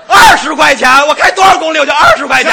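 high, loud mock-outraged shout; outraged driver. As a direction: explode at the toll price. Acting outrage at the toll. (夸张地高声喊着抗议收费)

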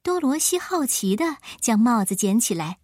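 usual speaking voice

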